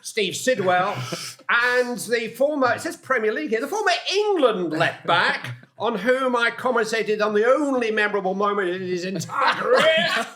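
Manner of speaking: high, loud, building match-call delivery; commentator intro. Acted. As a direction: announce him like a goal call, rising to a laugh. Commentator intro in full flow.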